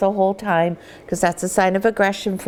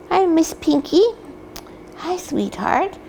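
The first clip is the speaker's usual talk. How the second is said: puppy talk; 8 semitones higher than usual. Real, not acted. Breeder greets puppy lovingly.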